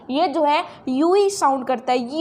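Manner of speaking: ordinary talk, nothing marked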